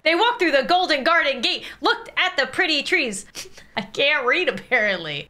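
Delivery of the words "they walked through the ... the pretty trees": high, hammed-up children's storyteller; better storybook voice. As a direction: read it with overblown singsong delight. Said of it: "I could do a better voice".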